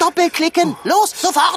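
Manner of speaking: loud, high urgent orders; urgent commands. Acted. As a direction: push hard to hurry. Urgent escape from warthog.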